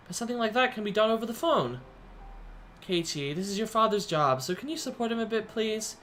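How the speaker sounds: lifted, gentle mother on the phone; a mother coaxing her son to be patient. Acted.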